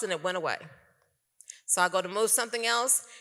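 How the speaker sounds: usual speaking voice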